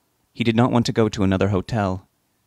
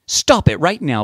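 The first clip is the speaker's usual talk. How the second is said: loud, raised-pitch reprimand; scolding; 7 semitones higher, 5 dB louder than usual. A narrator's reading. Betty reprimands her screaming husband, scolding. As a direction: scold him to stop at once. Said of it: "Betty scolded him".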